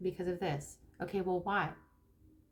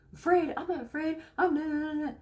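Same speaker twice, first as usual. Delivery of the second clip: higher-pitched, indignant mock protest; defensive denial; 6 semitones higher, 3 dB louder than usual. People reacting negatively to being asked about fear, defensive denial. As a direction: act out someone hotly denying it. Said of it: "when people react negatively".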